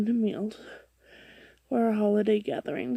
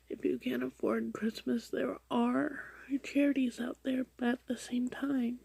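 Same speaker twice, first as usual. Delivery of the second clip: whispering; 2 semitones higher, 8 dB quieter than usual. Real, not acted. Whispered commentary on the post.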